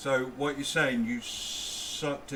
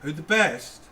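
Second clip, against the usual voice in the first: shouted call-back; 4 semitones higher, 7 dB louder than usual. Real, not acted.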